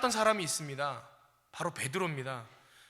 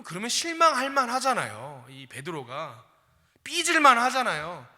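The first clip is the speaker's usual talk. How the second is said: ordinary delivery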